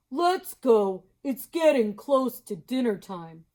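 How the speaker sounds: slow, loud, friendly moose. Acted. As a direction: draw it out slowly and loudly. Mister Moose voice.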